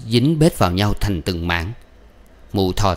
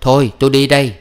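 higher, louder brusque parting; hurried goodbye; 5 semitones higher, 5 dB louder, faster than usual. A narrator's reading. Departing mother breaking off the farewell, hurriedly. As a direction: cut it off and get away. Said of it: "Mụ quay lại nói vội vàng".

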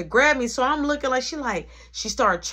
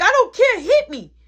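loud, high-pitched dare as the brother; defiant outburst; 13 semitones higher, 11 dB louder than usual. Retold or imitated. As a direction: shout it back, imitating a defiant teen. Brother's defiant outburst during beating.

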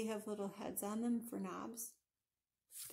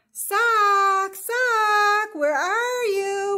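loud, high, drawn-out storybook wail; hammed-up cry of distress; 12 semitones higher, 20 dB louder, much slower than usual. A narrator's reading. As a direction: wail for something lost, stretching each word. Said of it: "wailed Pippin".

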